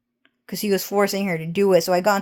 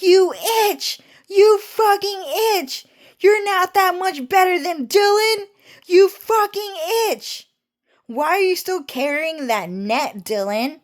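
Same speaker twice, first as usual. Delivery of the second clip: mean sister; 10 semitones higher than usual. Retold or imitated. Cho imitating his mean sister yelling insults at him. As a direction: screech the insults like the mean sister, half laughing. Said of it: "is kinda like".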